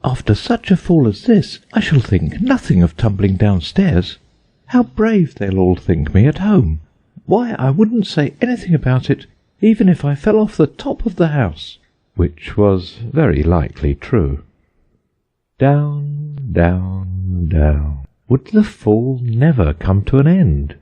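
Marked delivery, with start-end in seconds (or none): 0.0-11.8 put-on lightly raised girlish voice, boasting; a hint of a proud little girl; young Alice. A narrator's reading. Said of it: "thought Alice to herself". 15.6-18.0 drawn-out falling words, sinking each time; dramatic storytelling. A narrator's reading.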